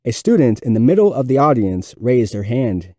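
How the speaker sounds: ordinary delivery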